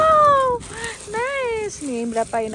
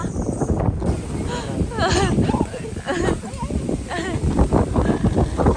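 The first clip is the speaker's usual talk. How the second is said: loud laugh; 10 semitones higher, 5 dB louder than usual. Real, not acted. Laughing at wind and plastic.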